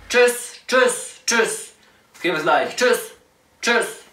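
high chirpy tschüss; high singsong goodbye. Pretend.

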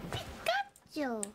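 high slow squeaky Pikachu. Retold or imitated. Pikachu imitation.

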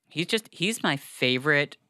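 normal talking voice

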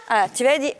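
usual speaking voice

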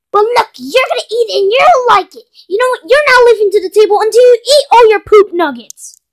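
high, loud, insistent scolding; fed-up parent yelling; stern ordering. Acted. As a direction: lay down the law at the dinner table. Forcing boys to eat.

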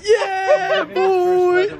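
high excited whoop; hyped shout. Acted. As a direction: whoop it like a hype man. Hyped weatherman catchphrase.

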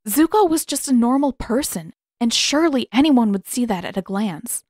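plain reading voice

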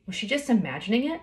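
ordinary delivery, nothing marked

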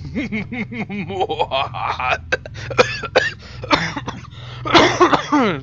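hammed-up villain laugh, drawn out; a long, put-on sinister chuckle; mock evil laugh. Player caps castle of nightmares announcement, mock evil laugh.

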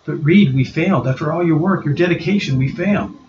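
put-on young woman crushed by failure, pleading; Susan despairing.

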